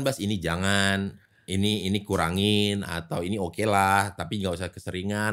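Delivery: soft, slow motherly caution; low and gentle, like a parent advising; gentle parental warning. Retold or imitated. Parents' warning voiced in question.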